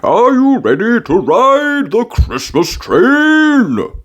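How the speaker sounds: high, slow jolly daddy voice; an excited dad teasing the kids; playful, drawn-out fatherly invitation; Daddy Pig's voice. A narrator's reading. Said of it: "said Daddy Pig".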